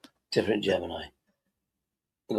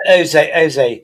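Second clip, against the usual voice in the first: loud, higher call for attention; 3 semitones higher, 9 dB louder than usual. Real, not acted. Friend interrupts to get his mate's attention, calling out. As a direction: call out to a friend over the chatter.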